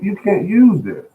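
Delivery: "use" drawn out high and slow; insistent scolding. Retold or imitated. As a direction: lean hard on "use", scolding himself. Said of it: "I'm gonna say".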